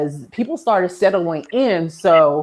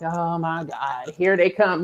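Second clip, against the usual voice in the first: dreading groan; 3 semitones lower, 5 dB quieter, slower than usual. Retold or imitated. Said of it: "I'd be like".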